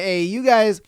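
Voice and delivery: normal talking voice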